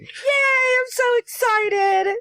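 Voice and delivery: high, slow gleeful cheer; excited squeal. Acted. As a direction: squeal with mock delight. Cheering disco magic return.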